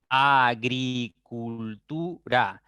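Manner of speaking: "Agricultura" slowly as a - gri - cul - tu - ra; syllable by syllable. Shown as a demonstration. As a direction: spell out "Agricultura" in five drawn-out beats, a, gri, cul, tu, ra. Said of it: "Tengo cinco sílabas".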